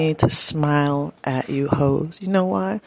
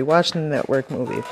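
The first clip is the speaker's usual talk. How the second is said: ordinary delivery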